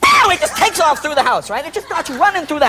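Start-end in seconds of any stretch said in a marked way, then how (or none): 0.0-0.3 shouted burst of a bang; sound effect. Retold or imitated. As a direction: blurt out a loud pow. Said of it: "and it goes, and it goes".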